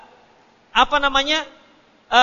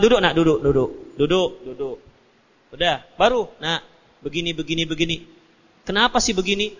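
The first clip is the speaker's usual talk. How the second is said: gentle parent; 8 semitones lower, 5 dB quieter than usual. Retold or imitated. Parent calmly seats a naughty child, low and soft. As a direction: lower and softer, calmly seating a child. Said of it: "Tidak, dia didudukkan".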